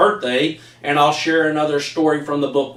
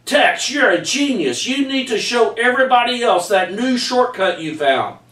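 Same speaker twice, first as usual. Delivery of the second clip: put-on excited camper boy; 8 semitones higher than usual. Camper Barnaby praises Tex.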